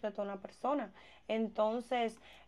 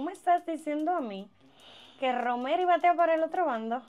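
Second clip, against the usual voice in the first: shocked disbelief; 5 semitones higher, 5 dB louder than usual. Acted. Friend shocked by revelation.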